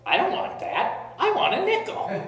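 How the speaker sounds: high-pitched imitation of an insistent child; mock childish protest, pitch jumping way up; little girl refusing. Little girl turning down dad's five dollar bill, imitated indignant child. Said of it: "looks at him like he's nuts".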